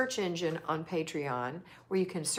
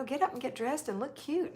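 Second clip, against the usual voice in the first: ordinary talk, nothing marked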